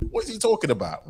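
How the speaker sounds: high, disbelieving question; incredulous. Retold or imitated. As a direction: ask it in total disbelief. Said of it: "I was like".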